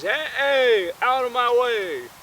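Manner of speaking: high, loud shout; driver's shout. Retold or imitated. Dad in his Tahoe, shouting at drivers. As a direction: yell to clear the road. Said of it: "And he says".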